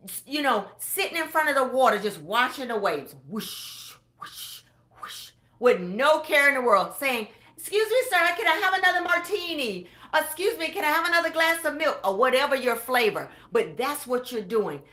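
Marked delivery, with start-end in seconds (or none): 3.3-5.3 wave whoosh. Retold or imitated. Imitating waves on the beach. 7.6-12.0 higher, airy mock order to a waiter. Retiree on the beach asking a waiter for a drink, higher and carefree.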